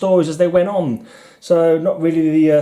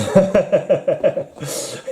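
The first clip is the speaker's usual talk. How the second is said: amused laugh; 4 semitones higher than usual. Real, not acted. Laughs at own voice.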